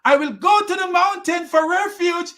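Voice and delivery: high-pitched mock boy voice; son's voice. Son replying to his father Noah, high mock voice. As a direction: pitch it right up as the foolish son.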